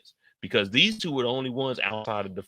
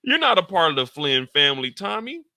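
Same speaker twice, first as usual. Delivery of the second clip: mock disbelief; 7 semitones higher, 4 dB louder than usual. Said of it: "I'm like".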